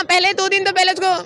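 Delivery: ordinary delivery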